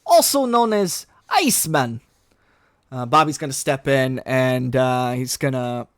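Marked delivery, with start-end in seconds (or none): 0.1-2.0 higher, louder gentle giant; big friendly mentor welcoming students; Colossus voice. Acted.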